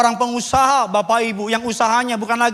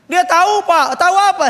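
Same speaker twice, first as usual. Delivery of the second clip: high, loud mock-surprised question; retold questioning; 10 semitones higher, 5 dB louder than usual. Pastor presses the man about his wife, high and loud. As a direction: pounce on it like an exaggerated interrogation. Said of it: "Jemaat gitu kalau ngomong tuh suka pendek-pendek".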